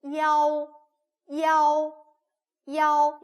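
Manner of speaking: 이아오 sung out high and loud as one syllable; Chinese model syllable. Shown as a demonstration. Teacher modelling 이아오 to students, loud and high. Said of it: "그냥 읽으시면 돼요".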